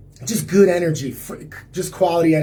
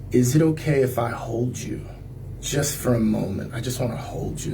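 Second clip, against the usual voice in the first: soft, gentle asking; tender request; 6 semitones lower, 5 dB quieter than usual. Retold or imitated. To Chi after coffee, asking for a hug, soft and tender. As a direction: ask her softly for a moment's embrace.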